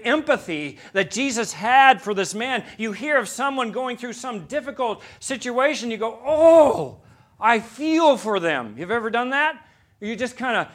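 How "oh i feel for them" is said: a high, loud groaning "Oh" before "I feel for them"; sympathetic groan. Retold or imitated. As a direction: groan out your pity for a friend. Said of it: "it just comes out as a groan".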